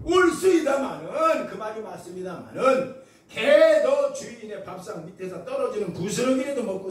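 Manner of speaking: loud, high, stretched-out cry; acted woman pleading with full force; impassioned plea. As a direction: push each phrase out as begging. Woman answers Jesus, begging.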